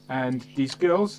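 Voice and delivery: ordinary talk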